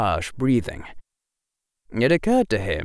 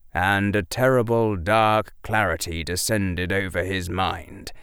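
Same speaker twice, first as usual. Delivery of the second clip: ordinary delivery, nothing marked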